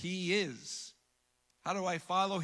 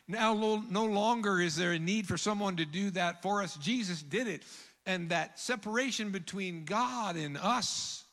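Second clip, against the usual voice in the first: louder, higher, driving sermon climax; preaching at full force; 4 semitones higher, 4 dB louder than usual. Acted. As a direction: lift it into a ringing proclamation. Preaching climax over music.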